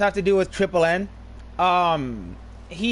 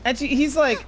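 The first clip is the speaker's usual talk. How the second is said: ordinary talk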